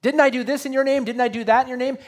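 loud, high, fast protest; pleading claim. Retold or imitated. Preacher voicing people protesting to the Lord, loud and high. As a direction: insist loudly you know him. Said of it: "as a way of saying".

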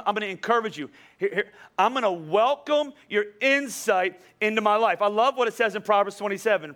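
ordinary talk

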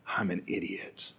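hushed, resigned self-blame; rueful self-reproach. Acted. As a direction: murmur it as an inner thought. Voicing regretful inner thought.

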